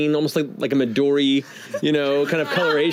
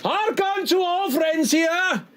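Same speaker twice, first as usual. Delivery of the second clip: high, slow theatrical proclamation; orcish barkeep announcing; slower than usual. Acted. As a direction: proclaim it to the whole bar like a herald. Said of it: "orcish gentleman, stands up at the top and goes".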